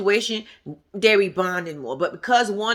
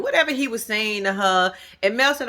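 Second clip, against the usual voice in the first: normal talking voice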